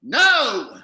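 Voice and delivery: loud, sharp correction; indignant no. Acted. Host loudly corrects his partner about currency. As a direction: shut down the silly idea at once.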